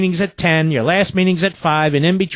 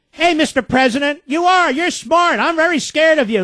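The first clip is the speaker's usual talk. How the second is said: much higher, sarcastic sing-song praise; sarcastic mock fear aimed at the president; mock flattery; 10 semitones higher than usual. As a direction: taunt him with fake awe.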